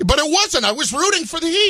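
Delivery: high-pitched, insistent protest. Real, not acted. Host denying to co-host that he was sarcastic, protesting. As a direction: push back against the accusation, pitch jumping up.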